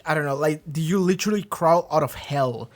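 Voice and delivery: normal talking voice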